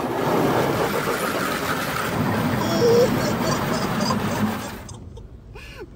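long high, airy helpless laugh; wheezing nervous laughter; long breathless laugh. Real, not acted. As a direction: laugh the fright out. Long laugh after the scare.

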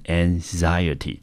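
"anxiety" said ang-ZAI-uh-tee, second syllable stressed. Shown as a demonstration. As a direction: model "anxiety" as a whole word, leaning on "zai". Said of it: "重音落在第二个音节".

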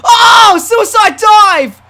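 high, loud, stretched yell; startled excited shout. Real, not acted. Gamer calling a suicide dive, excited shout. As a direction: burst out hollering the move.

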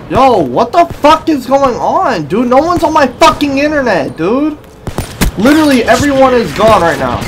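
loud high-pitched rage at lag; frustrated yelling. Real, not acted. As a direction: yell it out, fed up. Raging at lag mid-game.

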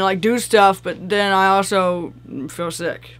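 normal talking voice

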